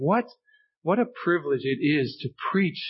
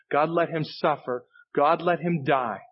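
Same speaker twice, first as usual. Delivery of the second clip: usual speaking voice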